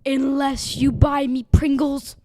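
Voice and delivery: loud angry demand; angry shout. Acted. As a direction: shout it like a furious clerk. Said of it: "the angry gas station person said".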